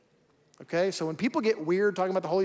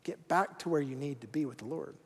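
much softer, lower and tender; gentle and kind; 5 semitones lower, 11 dB quieter than usual. Retold or imitated. God gently telling a straying believer to turn back, soft and kind. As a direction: coax them home gently, almost hushed. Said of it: "gently and kindly says".

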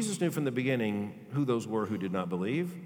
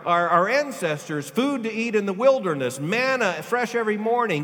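full-force preaching; 8 semitones higher, 7 dB louder than usual. Acted. Preacher to his congregation, full-force preaching. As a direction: preach it out with fire.

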